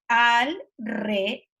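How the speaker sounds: "Al, re" as two separate drawn-out syllables. Shown as a demonstration. Explaining a hard-to-spell word, "Al, re" syllable by syllable. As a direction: sound out "Al" strongly, then "re". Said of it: "es una palabra difícil de escribir".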